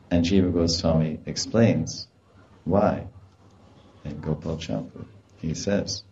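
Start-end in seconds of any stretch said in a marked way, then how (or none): none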